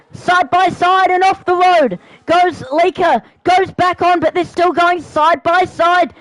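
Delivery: fast, rising, excited play-by-play; live race commentary. Acted. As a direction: call the race like a live broadcaster.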